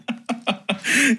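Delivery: a high burst of real laughter. Real, not acted. Laughing at cohost's joke.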